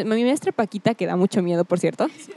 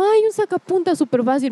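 higher-pitched imitation of the teacher; mimicked teacher; 8 semitones higher than usual. Girl replays her teacher's realization, mock breezy. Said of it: "cuando dijo así como".

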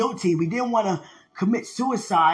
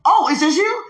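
high, louder surprised question; 6 semitones higher, 5 dB louder than usual. Retold or imitated. Hosts finding the preacher came alone, surprised. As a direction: react in loud astonishment.

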